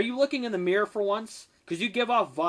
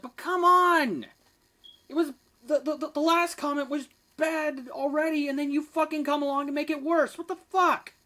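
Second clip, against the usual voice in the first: pitched-up frustrated rant at the commenter; exasperated outburst; 8 semitones higher than usual. Real, not acted. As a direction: throw up your hands and rant in disbelief.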